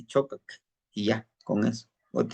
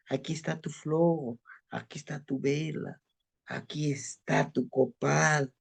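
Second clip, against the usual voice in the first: softer and softer, fading into a whisper; hushed prayer; 6 dB quieter than usual. Shown as a demonstration. Teacher translating the prayer, hushed to a whisper. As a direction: offer each gift in a hushed voice. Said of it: "Es parte de una plegaria".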